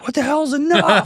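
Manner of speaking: high, indignant mock yell; angry outburst. Husband retorting to wife's "enough", comically angry high yell. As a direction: re-enact exasperated disbelief at a high pitch. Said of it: "I angrily was like".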